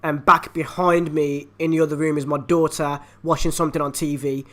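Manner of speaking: ordinary talk, nothing marked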